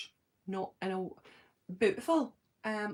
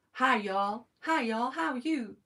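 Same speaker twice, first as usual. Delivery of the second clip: higher-pitched attempt at an American accent; 3 semitones higher, 4 dB louder, slower than usual. Shown as a demonstration. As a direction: try a hammy American "y'all" greeting. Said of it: "do an impression of American accent".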